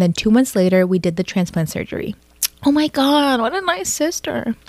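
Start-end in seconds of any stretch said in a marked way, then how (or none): 2.6-4.6 high, slow and moved; a much higher, slow oh my God of admiration; touched. Real, not acted. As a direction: let it melt out in awe. Reacting to the liver donation story.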